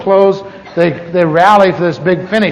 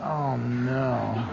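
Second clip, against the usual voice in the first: embarrassed groan; 9 semitones lower, 14 dB quieter than usual. Retold or imitated. Embarrassed by crying people.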